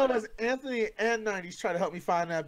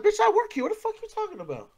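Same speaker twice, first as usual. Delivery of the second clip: Pookie's sass; 13 semitones higher, faster than usual. Retold or imitated.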